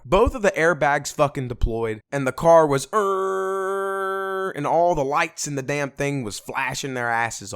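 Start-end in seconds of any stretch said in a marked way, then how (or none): 2.9-4.5 short strained car noise; imitated car groan. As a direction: voice the busted car.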